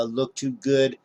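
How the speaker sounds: ordinary delivery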